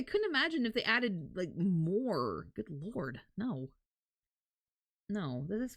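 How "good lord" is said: ordinary talk, nothing marked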